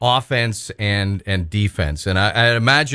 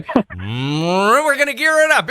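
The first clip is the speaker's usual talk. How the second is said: announcer voice; 9 semitones higher, slower than usual. Retold or imitated. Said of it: "you were warming that one up".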